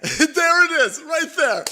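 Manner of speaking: high, loud laughing there it is; laughing gotcha. Real, not acted. Crowing with laughter at the streamer's quote, high and loud. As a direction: crow with laughter at catching her out. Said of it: "laughing gotcha".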